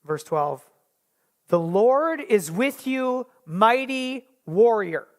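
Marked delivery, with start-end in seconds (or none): 1.5-5.1 slow, measured scripture reading. Shown as a demonstration. Preacher reading the verse to the congregation, slow and measured. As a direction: lay each word down slowly. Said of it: "So the N I V says".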